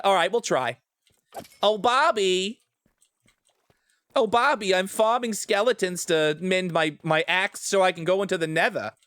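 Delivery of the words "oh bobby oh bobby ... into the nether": put-on Linda Belcher Long Island accent. Asked by friends to do the accent, a high Long Island mom voice. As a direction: coo Oh, Bobby like a doting Long Island mom. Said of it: "have me do this accent".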